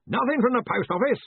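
hearty, forceful query; blustering interruption. A narrator's reading. Ridcully interrupts loudly.

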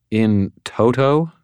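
slow, disbelieving echo of in toto; incredulous echo. Acted. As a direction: repeat in toto like it's absurd. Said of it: "Who talks like that?".

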